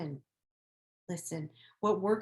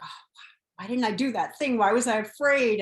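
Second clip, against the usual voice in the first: exasperated self-reproach; 4 semitones higher, 8 dB louder than usual. Retold or imitated. Retelling her own self-doubt.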